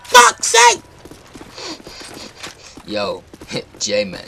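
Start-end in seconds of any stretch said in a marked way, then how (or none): 0.0-0.8 loud, high-pitched exasperation; exasperated shouting. Acted. Player fed up with the game, exasperated high shouting. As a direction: yell it, pitch shooting up.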